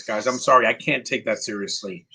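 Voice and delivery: ordinary delivery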